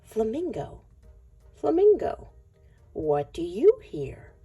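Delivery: ordinary delivery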